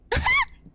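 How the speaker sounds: very high yelp of fright; short high scream; frightened squeal. Real, not acted. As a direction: squeal in panic. Monster scare in game.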